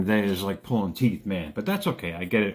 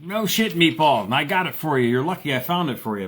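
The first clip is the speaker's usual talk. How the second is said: sassy retort; 5 semitones higher, 3 dB louder than usual. Retold or imitated. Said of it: "I'm like".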